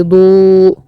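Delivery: ضوء stretched very slowly, oo held; rounded damm. Shown as a demonstration. Teacher giving an example word, long drawn-out ضوء. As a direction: hold a loud, rounded oo in ضوء. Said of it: "لازم نضم كده بقى".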